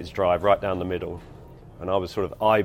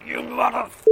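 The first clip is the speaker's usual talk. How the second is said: cut-off swear, much higher pitch; furious outburst as he rips the glove off; angry curse. Retold or imitated. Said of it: "he's like".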